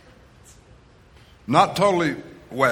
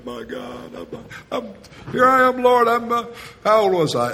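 much higher, breaking cry of despair; crying out to God; 9 semitones higher than usual. Retold or imitated. Preacher reliving his lament to God, desperate wail. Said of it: "and I cried".

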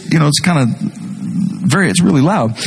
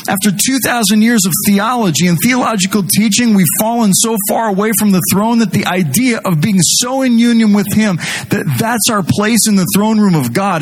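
higher, impassioned preaching voice; fervent preaching; 8 semitones higher than usual. Acted. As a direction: preach it with building fire. Preaching with rising intensity.

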